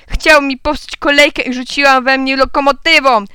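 put-on loud, high childish tattling; shouted accusation. A narrator's reading. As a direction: complain loudly to the adults. (głośna, wysoka skarga)

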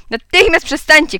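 loud, high scolding command; shouted order. A narrator's reading. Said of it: "krzyknęła pani Patmolier". (głośny, wysoki rozkaz)

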